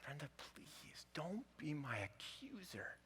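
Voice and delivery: slow, soft tearful whisper; husband pleading to his wife in a slow whisper. Retold or imitated. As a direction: beg her through tears in a whisper. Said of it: "through the tears".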